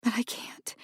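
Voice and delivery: mother whispering to her son, hopeless. Acted. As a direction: barely say it. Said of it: "she whispered".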